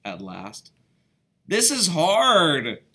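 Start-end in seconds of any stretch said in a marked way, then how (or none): 1.5-2.8 loud, slow, stretched complaint; boy loudly despairing over the press; exasperated exclaim. Acted. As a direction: drag it out in loud frustration. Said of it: "exclaimed Wilbur".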